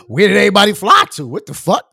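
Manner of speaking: loud incredulous question; loud baffled question; baffled outburst. Real, not acted. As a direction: throw the question out loud in disbelief. Baffled about airport crowds.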